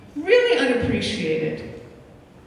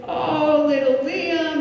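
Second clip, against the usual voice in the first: higher, cooing mother's voice; gentle mother; 5 semitones higher, slower than usual. Retold or imitated. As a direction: coo to a small child, imitating a tender mother. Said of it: "she takes him out gently".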